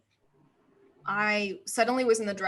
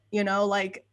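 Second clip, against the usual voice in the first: ordinary talk, nothing marked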